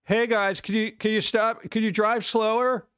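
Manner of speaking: high-pitched pretend call-out to drivers; mock yelling. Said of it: "Yell at traffic?".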